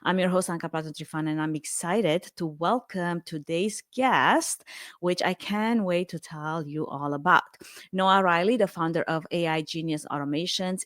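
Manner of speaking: measured, scripted host introduction; podcast intro read. Acted. Host introducing an AI founder to listeners, scripted intro read. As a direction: present the guest like a prepared read, key words leaned on.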